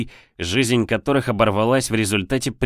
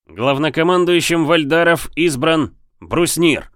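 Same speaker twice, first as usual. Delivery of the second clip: higher, louder proclamation; formal announcement; 7 semitones higher, 4 dB louder than usual. A narrator's reading. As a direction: announce it to the crowd. Announcing the winner.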